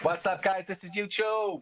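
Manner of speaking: YouTuber voice. Pretend. Mock YouTuber greeting.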